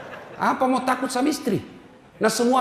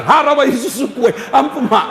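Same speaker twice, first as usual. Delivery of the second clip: louder, higher quick exchange; a hint of a mother's outburst; mock mother's threat; 5 semitones higher, 6 dB louder than usual. Mother's threat and son's plea. (ancaman ibu, keras)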